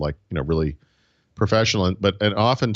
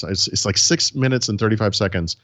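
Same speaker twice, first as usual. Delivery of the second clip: normal talking voice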